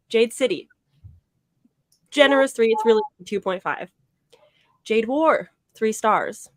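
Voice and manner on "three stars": usual speaking voice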